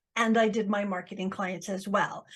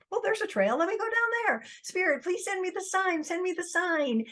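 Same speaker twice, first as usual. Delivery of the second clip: much higher, eager and hopeful; excited; 10 semitones higher than usual. Retold or imitated. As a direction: lift the voice high with eager hope. Excited on the trail.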